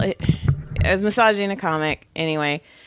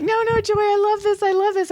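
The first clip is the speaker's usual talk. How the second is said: delighted client; 12 semitones higher, faster than usual. Retold or imitated. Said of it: "And she's laughing".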